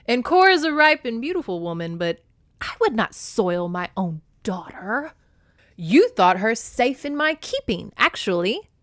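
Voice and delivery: loud, protesting king of gods; exclaiming. A narrator's reading. Zeus protests his innocence to Demeter, exclaiming. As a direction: exclaim it, offended at the charge. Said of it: "Zeus exclaimed".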